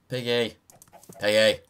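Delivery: loud high call to an animal. Real, not acted. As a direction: call the pig loudly. Calling to pig in tree.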